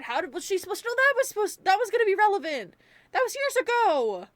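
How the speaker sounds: high, loud indignant rant; incredulous outburst. Real, not acted. Reviewer defending Vanessa against blame, incredulous and worked up. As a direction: rush it out high, in outraged disbelief.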